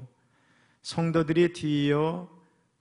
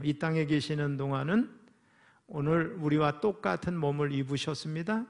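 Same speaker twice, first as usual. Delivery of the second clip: normal talking voice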